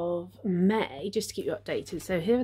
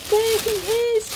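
high, loud delighted cry; real thrill at the unwrapping; excited reveal; 6 dB louder than usual. Real, not acted. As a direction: present the prize like a treat. Unwrapping the giveaway prize reveal.

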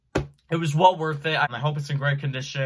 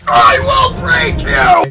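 huge slow bellowed threat; barbarian; 15 dB louder, much slower than usual. Pretend. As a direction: roar it like a barbarian. Shouted threat at the bubble wrap.

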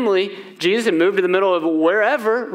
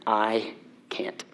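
soft, unvoiced whisper; 7 semitones lower, 9 dB quieter than usual. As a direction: breathe it out, defeated. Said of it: "Exactly what I said".

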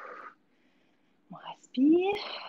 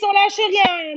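gym bro; 5 semitones higher, 6 dB louder, much slower than usual. Real, not acted. Coach pushes through the countdown.

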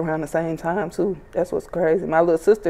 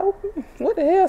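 mock surprise; 13 semitones higher, 4 dB quieter, slower than usual. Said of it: "I was like".

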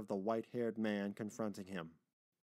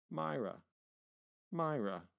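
put-on higher, falling call of a moved old man; old gentleman; 4 semitones higher than usual. Grandfather calling the child by name, tentative. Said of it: "repeated the old gentleman".

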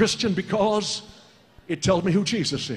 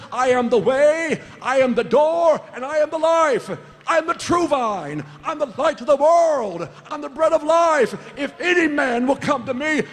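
raised, ringing sermon delivery; full-force preaching; 5 semitones higher, 3 dB louder than usual. Real, not acted. Preacher proclaiming Christ's words to the congregation, full-force preaching. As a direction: build into a loud, high-pitched declaration.

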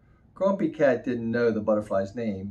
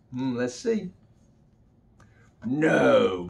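plain reading voice

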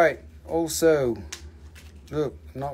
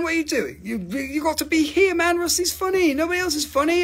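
pitched way up, whiny and teasing; high squeaky mock complaint; high mock whine; 12 semitones higher than usual. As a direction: plead like a wounded show-off, high and jokey. Mock pleading voice to viewers.

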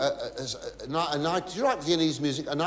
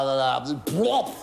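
ordinary talk

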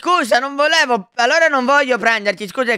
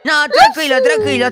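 alarmed reassurance; 9 semitones higher, 4 dB louder than usual. Pretend. Hurriedly reassures frightened Toad.

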